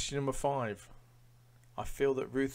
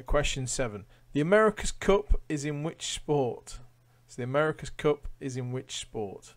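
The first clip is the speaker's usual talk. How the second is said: louder quizmaster read-out; quiz question read; 4 semitones higher, 9 dB louder than usual. Acted. Quizmaster reading a question to online quiz players, announcer style. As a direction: announce it to the room.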